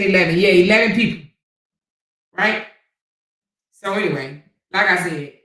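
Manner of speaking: normal talking voice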